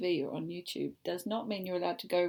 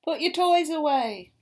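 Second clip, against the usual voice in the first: much louder, higher command; loud order; 6 semitones higher, 11 dB louder than usual. Acted. Mother reading the book, telling David loudly to tidy. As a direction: bark the order.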